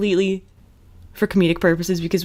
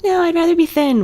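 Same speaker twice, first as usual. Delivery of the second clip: high loud mock Claire; 10 semitones higher, 5 dB louder than usual. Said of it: "And she's like".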